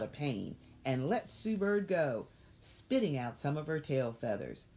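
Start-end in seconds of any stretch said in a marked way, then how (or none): none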